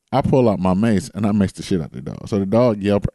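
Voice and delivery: ordinary talk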